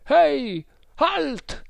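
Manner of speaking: high, drawn-out distant hail; shouted challenge. A narrator's reading. Voice from the riverbank halting a boat, high shout. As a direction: call out sharply high across water. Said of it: "si udì una voce gridare".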